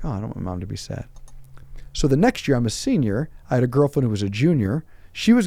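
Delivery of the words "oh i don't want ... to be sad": quiet inner thought. Retold or imitated. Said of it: "I was like".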